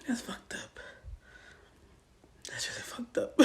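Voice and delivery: hushed whispered aside. Real, not acted. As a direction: breathe it to yourself. Whispered aside after story.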